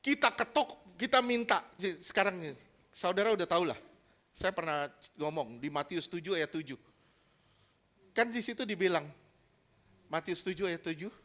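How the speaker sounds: usual speaking voice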